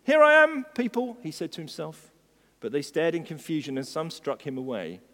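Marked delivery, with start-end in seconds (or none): 0.0-1.2 high, loud and swaggering; proud announcement. A narrator's reading. Little colt presenting himself to the market crowd, proudly. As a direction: proclaim yourself grandly to the crowd. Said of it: "With his ears held high with pride".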